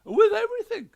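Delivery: very high, very loud snap; snapped. A narrator's reading. Ghost wife snaps at the detective, very high and very loud. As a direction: bite off the words sharply. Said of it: "she snapped".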